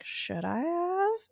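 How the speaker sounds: slow, high, rising tease; mock-coy, drawn-out retold question. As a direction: float it up suggestively. Wife coyly tests husband's interest.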